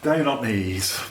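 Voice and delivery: ordinary talk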